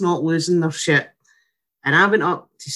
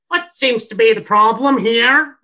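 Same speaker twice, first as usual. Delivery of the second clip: put-on stern Northern Irish accent, pitched up; brisk, no-warmth Northern Irish voice; Arlene Foster; 5 semitones higher, 4 dB louder than usual. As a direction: imitate a formidable Northern Irish ward sister. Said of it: "Like big fucking Arlene Foster".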